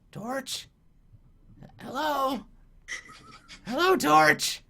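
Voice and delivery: high, tentative goblin calling. Acted. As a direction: softly hail the torch as a curious goblin. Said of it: "And then he's like".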